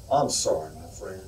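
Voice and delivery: a touch of solemn country neighbor; somber neighbor. Acted. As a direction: break the news gently and gravely. Neighbor's final condolence.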